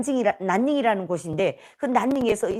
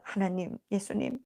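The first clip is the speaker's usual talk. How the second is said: hushed, lower-pitched invocation; retold prayer; 4 semitones lower, 9 dB quieter than usual. Girls begin praying over the sleeper, hushed invocation. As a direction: address God softly and reverently. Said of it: "기도하기 시작했습니다".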